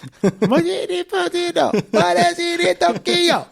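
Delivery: high, loud laugh; high laughing burst. Real, not acted. Laughing with a friend over the soundtrack, high and loud. Laughing hard about the movie.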